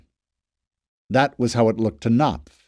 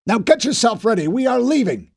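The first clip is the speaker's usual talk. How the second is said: angry order; 10 semitones higher, 3 dB louder than usual. A narrator's reading. Fisher ordering Knopf.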